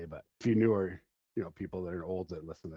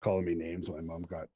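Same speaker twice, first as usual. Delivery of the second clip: normal talking voice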